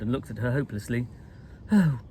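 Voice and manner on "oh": higher, breathless hope; gasped. A narrator's reading. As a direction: gasp it out, relieved and desperate. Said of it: "she gasped".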